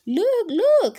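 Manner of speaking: high, loud call from above; calling from the sky. A narrator's reading. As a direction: shout down to get her attention. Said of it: "said a voice in the sky".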